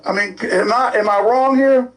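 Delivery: ordinary talk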